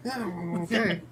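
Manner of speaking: ordinary talk, nothing marked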